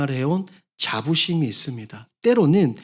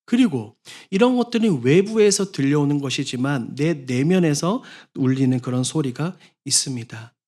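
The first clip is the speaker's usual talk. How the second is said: usual speaking voice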